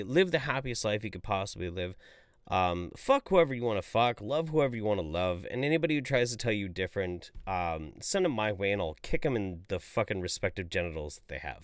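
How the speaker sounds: usual speaking voice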